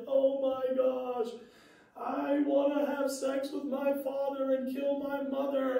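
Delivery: high, strained teary plea; imitated tearful wail. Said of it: "Danielle is crying".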